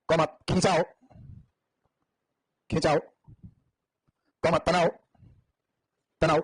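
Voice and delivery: unhurried classroom dictation. Acted. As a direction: read it out word by word for the class to write down. Teacher dictates notes slowly.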